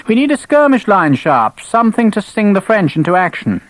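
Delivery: high, clipped officious officer; stiff, self-important lieutenant; stern young officer. Acted. Gibbons demanding.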